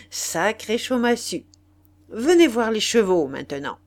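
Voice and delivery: aristocrate expansif, voix forte; marquis bonimenteur. Acted. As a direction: vendeur triomphant. Le marquis conclut la vente. (marquis sûr de lui, fort)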